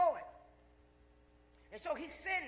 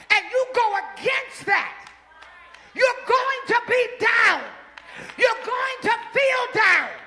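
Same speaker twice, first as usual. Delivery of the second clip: louder, slow and higher; slow preached warning; 9 semitones higher, 22 dB louder, much slower than usual. Acted. Preacher warning the church they will fall down. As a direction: hammer each word slowly.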